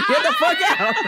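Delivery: high, loud, laughing get the fuck out; laughing shout. Real, not acted. Cracking up at her own cucumber joke, high laughing shout.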